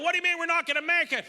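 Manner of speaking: high, loud alarmed yell; panicked yell. Retold or imitated. Patient yelling at the ambulance driver, high and loud. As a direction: shout it in a panic. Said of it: "So I yell".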